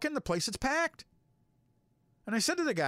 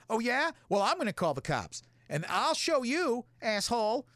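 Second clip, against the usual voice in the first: snotty defiant woman; 7 semitones higher than usual. Retold or imitated. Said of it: "And the chick's like".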